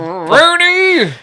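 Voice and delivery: high, loud mock cry; hammed-up call. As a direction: holler the name as a theatrical grandpapa. Grandfather bit, calling out the name.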